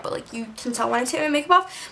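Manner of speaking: ordinary talk, nothing marked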